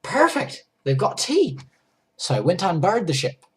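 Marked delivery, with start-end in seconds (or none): 0.0-1.6 bright, high delight at finding tea; a thrilled little cheer; excited. Retold or imitated. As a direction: squeal it out, much higher. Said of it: "I was like".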